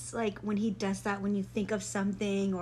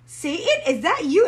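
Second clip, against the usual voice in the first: calling out; 7 semitones higher, 9 dB louder than usual. Retold or imitated. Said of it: "And I was like".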